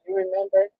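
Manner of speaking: little whisper. Retold or imitated. God gently reminding her of her past, in a whisper. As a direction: breathe it like a quiet nudge from above. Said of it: "a little whisper".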